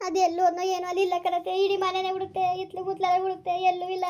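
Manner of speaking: high, sing-song tearful wail; keen the words like a lament. Acted. Distraught mother wailing that she searched the whole house.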